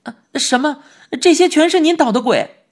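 惊叫; shocked cry. A narrator's reading. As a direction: cry out in disbelief. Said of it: "我叫了起来".

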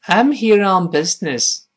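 high, slow model sentence, falling at the end; model reading. Shown as a demonstration. Teacher modelling the line for repetition, slow high model reading. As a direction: read "I'm here on business" slowly with short vowels in "business". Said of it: "我们读一下这句话".

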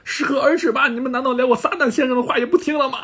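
high-pitched exasperated outburst; Mr. Satan. Retold or imitated. Satan scolding all the Earth's people, exasperated yell. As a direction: imitate a fed-up yell, voice pushed up high. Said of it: "撒旦真的忍无可忍了".

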